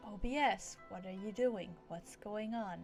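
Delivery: normal talking voice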